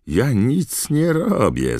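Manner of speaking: high, drawn-out mischievous squeal; cackling poltergeist. A narrator's reading. Poltergeist taunts the professor, cackling high and slow. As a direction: protest innocence in a high cackle. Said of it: "zarechotał Irytek".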